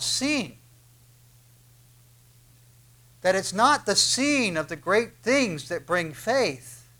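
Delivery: emphatic sermon delivery, drawn out; preaching. Acted. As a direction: press the point home from the pulpit. Preacher drives home his main point.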